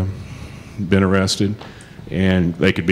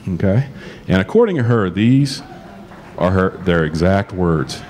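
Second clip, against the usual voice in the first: ordinary delivery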